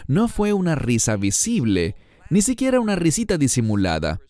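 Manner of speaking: usual speaking voice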